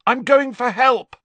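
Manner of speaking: high, loud shout over the wind; urgent shouted warning. Acted. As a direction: yell it to carry over a gale. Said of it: "he shouted at the others".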